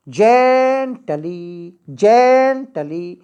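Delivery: "Gently" with a long, strong "जें" and light "टली"; stress on first syllable. Shown as a demonstration. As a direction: hold the "जें" of "gently", then drop off. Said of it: "इसको जोर से बोलेंगे, इसको धीमे". ("Gently" में "जें" पर ज़ोर, "टली" धीमे)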